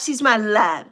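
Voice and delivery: normal talking voice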